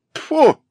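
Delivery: sharp high disgusted spit. Acted. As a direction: spit it out in contempt. Spitting in disgust.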